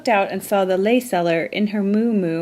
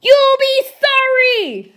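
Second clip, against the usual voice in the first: much higher, slow threatening screech; furious scream; 4 dB louder than usual. A narrator's reading. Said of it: "screamed the furious magic shark in his loudest voice".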